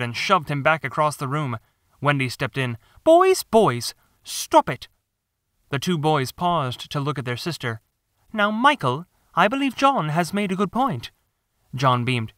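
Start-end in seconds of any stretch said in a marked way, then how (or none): 3.0-4.9 put-on high, louder scolding girl; sister scolding. Wendy scolds her fighting brothers, high loud girl voice. 8.3-11.1 put-on high girl voice, prim and firm; a big sister lecturing; young girl. Wendy tells Michael John has a point, high girl voice.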